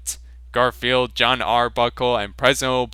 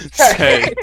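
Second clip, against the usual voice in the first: laughing; 7 semitones higher, 8 dB louder than usual. Real, not acted. Reader breaks into a laugh.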